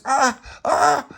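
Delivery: very high, loud wails; acted pain cries. Tony as his ear is pulled, acted pain cries. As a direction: wail twice in mock agony. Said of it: "like that".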